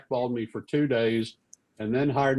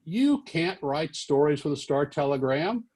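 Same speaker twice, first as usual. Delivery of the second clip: high, indignant scolding; acted-out irate reprimand; angry; 8 semitones higher than usual. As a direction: imitate an editor laying down the rules. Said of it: "was really angry".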